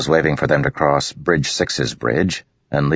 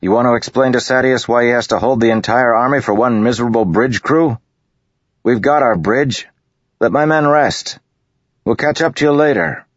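higher, louder, fast persuasion; pressing argument; 10 semitones higher, 5 dB louder than usual. A narrator's reading. Kaladin pressing Matal to leave them, raised fast argument. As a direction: talk the officer down. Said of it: "Kaladin arguing with Matal".

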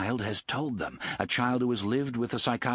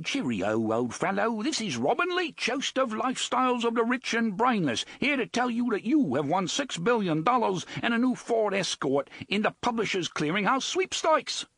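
put-on bogus British accent; 11 semitones higher than usual. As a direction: bellow like a hammed-up British TV host. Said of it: "adopting a plummy and completely bogus British accent".

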